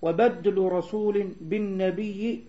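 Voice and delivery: slow, measured verse recitation; poem recitation. Real, not acted. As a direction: recite the line with drawn-out syllables. Reciting the verse once more. (إلقاء شطر شعر ببطء وتأنٍّ)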